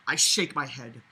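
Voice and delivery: ordinary talk, nothing marked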